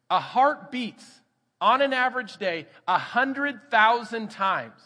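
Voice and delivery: slow, loud, emphatic preaching; slow emphasis. Acted. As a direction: stretch each word, slow and loud. Preacher stressing a big fact.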